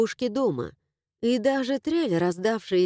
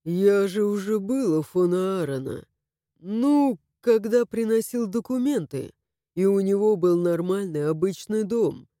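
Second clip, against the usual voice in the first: Harash male voice. A narrator's reading.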